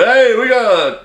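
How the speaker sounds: high-pitched, excited boast; mock bragging. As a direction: imitate companies crowing about their new product. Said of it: "They're all bragging".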